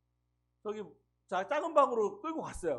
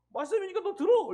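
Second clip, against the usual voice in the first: higher, louder mock retired pastor; mocking the retired pastor's blunt reply, higher and louder; imitating the pastor; 10 semitones higher, 7 dB louder than usual. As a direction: bark it like a stubborn old pastor. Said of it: "이러는 거예요".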